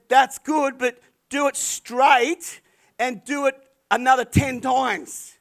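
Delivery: loud, slow call across the lawn. Retold or imitated. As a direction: call out the instructions over the noise of the mower. Calling to boy mowing lawn.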